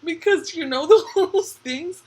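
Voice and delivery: high, loud, laughing exclamation; cracking up. Real, not acted. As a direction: burst out, tickled by the picture in your head. Cracking up at the pasta story.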